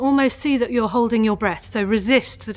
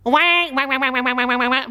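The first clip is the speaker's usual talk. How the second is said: unembarrassed duck laugh; 2 semitones higher than usual. Shown as a demonstration. A singing teacher urges a bold duck laugh. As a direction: quack like you mean it. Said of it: "as if you're not embarrassed".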